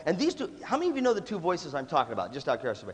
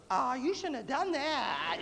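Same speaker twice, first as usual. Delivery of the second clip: high, long drawn-out mock lament; slow singsong hammed-up regret; reproachful conscience; 9 semitones higher, 3 dB quieter, much slower than usual. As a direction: stretch it out like a tut-tutting conscience. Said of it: "this is the first voice that goes".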